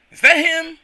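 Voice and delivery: squeaky high little worker voice; excited little helper voice; high Toad-like voice. Acted. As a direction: pitch it way up like Toad. Voicing apprentice, high pitched.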